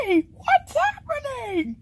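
high, drawn-out alarmed wail; panicked cry. Acted. Caught and squeezed, warning friends, high panicked cry.

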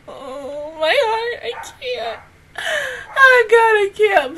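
high, teary cry. Real, not acted. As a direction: break down over the kiss. Overwhelmed by kiss scene.

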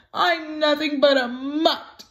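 tearful, high, crying puppy; hurt puppy sniffling to mom. A narrator's reading. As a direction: sniffle through the words. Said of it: "sniffled a sad Elmer".

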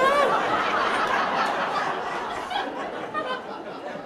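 a long, high, excited laugh; overjoyed laugh at the memory; real high burst of laughter. Real, not acted. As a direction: laugh helplessly, high and loud. Reliving the call from Oprah's office.

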